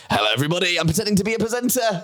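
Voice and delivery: mock presenter voice. Comedian mocking his own stage intro, in a put-on presenter voice. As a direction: play at being a slick host, a touch overdone. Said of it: "doing my presenting thing".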